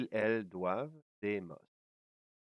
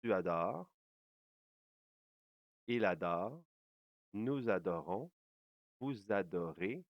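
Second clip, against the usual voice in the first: ordinary talk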